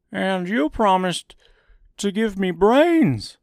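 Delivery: high, loud scarecrow voice; scarecrow character. A narrator's reading. Scarecrow reminding Oz of his promise, high scarecrow voice. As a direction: call it out high as an eager scarecrow.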